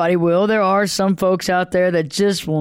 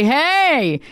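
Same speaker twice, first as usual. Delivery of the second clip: bright greeting; 7 semitones higher than usual. Shown as a demonstration. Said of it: "Do you say".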